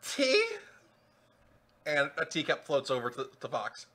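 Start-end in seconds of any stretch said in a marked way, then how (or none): 0.0-0.4 high-pitched questioning offer; Mad Hatter. Acted. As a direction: pipe it up as an eccentric invitation. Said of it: "the Mad Hatter turns and looks at Fox and goes".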